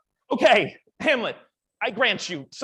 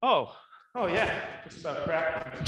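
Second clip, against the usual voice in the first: normal talking voice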